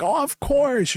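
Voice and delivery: high-pitched, gushing yes; eager agreement. Retold or imitated. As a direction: agree with an excited upward swoop. Retells his eager reply to mom.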